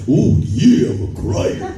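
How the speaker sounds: high, loud raspy wrestler voice; loud raspy boast, laughing; Macho Man voice. Retold or imitated. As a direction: growl it like a wrestler promo. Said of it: "so great coming out".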